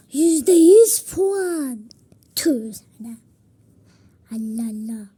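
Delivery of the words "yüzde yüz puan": louder and slowly drawn out, every syllable stretched; triumphant. Pretend. Child scoring her throw, slow loud triumph. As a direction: crow it like a game-show score.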